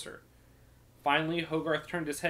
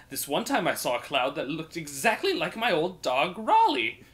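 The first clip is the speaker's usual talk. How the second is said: chatty minstrel; 3 semitones higher, 5 dB louder than usual. Acted. Minstrel rambling to a grumpy thief about clouds, sing-song. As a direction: ramble on like a delighted bard.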